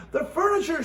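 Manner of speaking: high upset cry; cried out. A narrator's reading. Mrs Rogers dismayed at her furniture, crying out. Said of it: "she cried".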